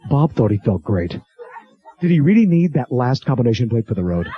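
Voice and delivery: low, grave announcer voice; mock-serious low announcer; public service announcement. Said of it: "public service announcements".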